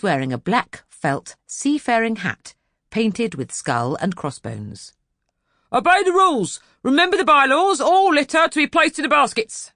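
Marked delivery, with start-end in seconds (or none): 5.7-9.7 much higher, loud bellowing park keeper. Acted. Park keeper shouting bylaws nobody heeds, loud high bellow. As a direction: bellow the rules at the crowd. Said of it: "he bellowed".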